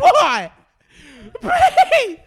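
very high, very loud, laughing plea; mock pleading squeal. Pleading to be cast, giddy laughing shriek. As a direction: beg for the part in a giddy squeal. Begging for the role.

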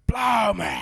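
Mel Gibson rant. Retold or imitated. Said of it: "I'm Mel Gibson".

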